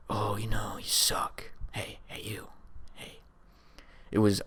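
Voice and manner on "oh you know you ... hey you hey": soft unvoiced taunting whisper. Hallucinated voices taunting him, creepy whisper. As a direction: whisper insults like voices in your head. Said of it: "voices start to, start talk shit on me".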